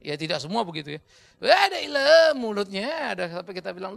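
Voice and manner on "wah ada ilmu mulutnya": loud, high, drawn-out gibe; slow, exaggerated imitation; mock exclamation. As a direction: stretch it out in mock amazement. Mimicking what people say of chatterer.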